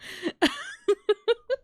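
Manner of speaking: squealing laughter; loud high laugh. Real, not acted. Big laugh.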